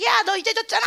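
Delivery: high-pitched taunting accusation; mock accusing. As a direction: play an accuser jeering at the defendant. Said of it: "막 고발을 해".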